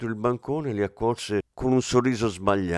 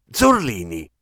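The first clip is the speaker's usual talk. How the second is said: sharp reproach; 6 dB louder than usual. Acted. Commissario reproaching his inspector.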